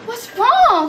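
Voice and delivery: very high, loud mock panic; freaking out. Teacher seeing student crying, freaking out. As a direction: cry out in hammed-up alarm. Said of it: "I was, like, freaking out".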